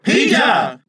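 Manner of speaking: high, loud call of a name; excited cry. Acted. Boys hail their returning leader, loud cry. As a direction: cry out, pitched right up. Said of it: "they cried".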